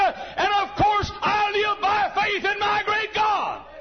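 high, loud, drawn-out climax; preaching shout. Real, not acted. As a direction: ring the name of God out. Sermon climax.

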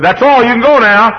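storyteller doing the nurse, high and bright. Retold or imitated.

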